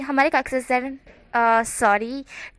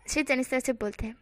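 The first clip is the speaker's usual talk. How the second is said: plain reading voice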